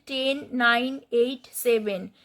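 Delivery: normal talking voice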